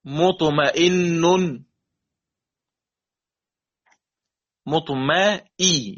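مطمئن slowly, the hamza with kasra made clear; slow tajweed demo. Shown as a demonstration. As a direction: model مطمئن for students. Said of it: "مثل". (مطمئن بتأنٍّ وتوضيح الهمزة المكسورة)

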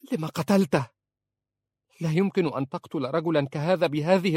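high, urgent accusing plea; frantic pleading. Acted. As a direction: beg for help, then turn on him. She pleads and accuses husband. (توسل محموم واتهام)

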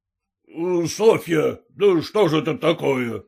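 usual speaking voice

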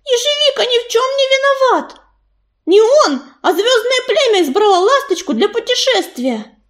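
high-pitched, heated defence of a friend; indignant protest. A narrator's reading. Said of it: "протестующе взмахнула хвостом и бросилась на защиту".

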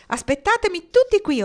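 high, loud excited command; order. A narrator's reading. Marnie ordering her friends to wait, loud command. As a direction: bark the order over your shoulder. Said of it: "ordinò".

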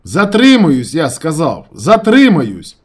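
high, very loud repeat; emphatic shouting. A narrator's reading. Narrator repeating himself to his friend, shouting. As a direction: yell it out, drawing out the last word.

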